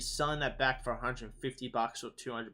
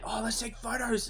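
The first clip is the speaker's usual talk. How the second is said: put-on much higher, eager kid voice; excited kids; 10 semitones higher than usual. Kids at the con spotting cosplayers, excited and high. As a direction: imitate thrilled little kids wanting photos. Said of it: "all you can see is just kids just like".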